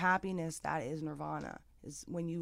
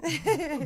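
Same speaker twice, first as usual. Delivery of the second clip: light laugh; 11 semitones higher, 7 dB louder than usual. Real, not acted. Laughs after divine intervention.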